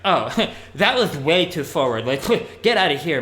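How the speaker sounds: laughing, incredulous brush-off; mock scornful rejection. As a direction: laugh them out of the room, playfully. Acting out a girl's imagined rejection.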